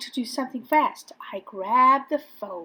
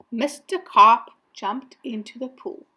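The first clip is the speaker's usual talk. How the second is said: ordinary delivery, nothing marked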